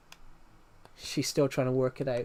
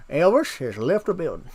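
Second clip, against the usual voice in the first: a light [name] impression. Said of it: "I sound like [name]".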